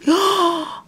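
high gasp of admiration; delighted gasp. Real, not acted. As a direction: gasp at something pretty. Delighted by trims.

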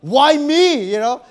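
high, loud whining complaint; indignant cry of why me; frustrated inner whine; exasperated. Retold or imitated. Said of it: "in my mind was like".

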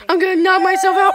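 loud, high and fast; frantic yell. Acted. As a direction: shout it in a panic.

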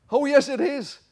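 very high, loud panto insistence; escalating hammed-up contradiction; pantomime comeback. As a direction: top the last retort, panto style. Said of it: "it's almost pantomime".

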